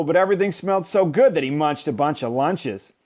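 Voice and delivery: plain reading voice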